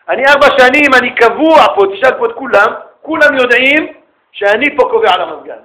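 high, loud boasting about seniority; loud bragging. Acted. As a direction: lord it over the new guy loudly. (התרברבות קולנית של ותיק)

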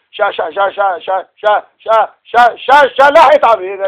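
repeated stuttering on שה before שלח את עמי. Retold or imitated. A stuttering leader speaking to the people, stammering. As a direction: stammer שה over and over, then force it out. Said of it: "יבוא אליו אחד מגמגם".

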